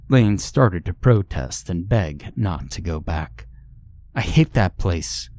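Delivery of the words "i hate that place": ordinary delivery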